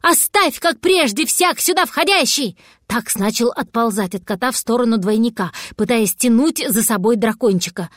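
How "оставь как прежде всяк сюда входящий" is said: high-pitched excited jabber; rattled off. A narrator's reading. Cat babbling nonsense to distract the dragon, high-pitched rattle. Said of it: "тарахтел кот".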